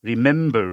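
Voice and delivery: "Remember" with short "রি" and a loud "মেম"; stress on second syllable. Shown as a demonstration. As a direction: hit the middle "মেম" of "remember". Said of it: "stress হচ্ছে".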